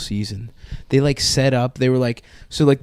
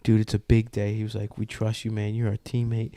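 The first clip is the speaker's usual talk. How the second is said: much softer, conspiratorial; quietly winning his trust; hushed reassurance; 4 semitones lower, 10 dB quieter than usual. Retold or imitated. Teammates reassure him quietly.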